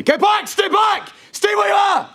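high-pitched, forceful shouted commands; demonstrated police shouting. Shown as a demonstration. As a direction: shout it like an officer ordering someone back on the street. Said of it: "demonstrate the level of shouting".

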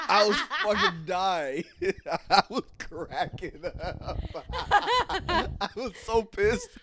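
helpless giggling through the words; real laughter. Real, not acted. Telling friends about being pranked, cracking up.

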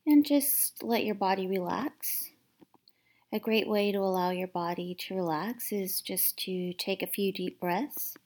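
gentle guided meditation voice, partly whispered; calm, hushed meditation guide. Acted.

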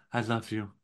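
softly spoken, understated declaration; quiet. Acted. Imitating the wife answering from home, quiet and soft. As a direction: murmur it softly, acting the wife. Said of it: "Just so quiet".

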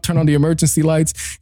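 ordinary talk

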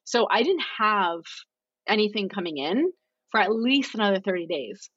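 ordinary delivery

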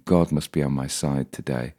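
usual speaking voice

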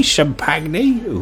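"champagne" slowly as sham-pag-nay; mocked mispronunciation. Diner doubting the French say it so, "champagne" as sham-pag-nay. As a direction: sound the g in "champagne", falling at the end. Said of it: "It's just you mispronouncing it".

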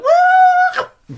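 very high, loud droid whoop; loud, high-pitched imitated robot woo; R2-D2 electrocution squeal. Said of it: "He's like".

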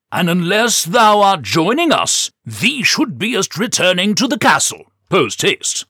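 put-on high, loud, old-fashioned knight; booming stage-knight proclamation; hammy knight voice. Knight character voice.

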